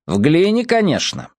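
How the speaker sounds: lightly voiced know-it-all lecturer; scholarly tone. A narrator's reading. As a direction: explain it like a learned professor. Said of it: "учёным тоном объяснил Дон".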